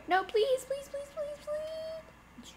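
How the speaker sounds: rising squeal that fades thin; desperate begging. Real, not acted. Begging as car gets away.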